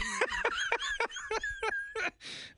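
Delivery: a high, wheezing laugh that tips into tears; helpless crying laugh. Real, not acted. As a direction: laugh until you're crying. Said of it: "I'm crying".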